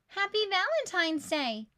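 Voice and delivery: high, loud joyful cheer. Acted. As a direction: cheer it like a class of kids. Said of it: "they cheered".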